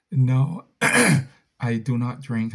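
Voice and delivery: ordinary delivery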